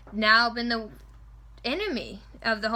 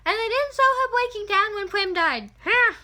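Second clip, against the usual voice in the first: exasperated; 9 semitones higher, 5 dB louder than usual. Real, not acted. Exasperated complaint about the movie.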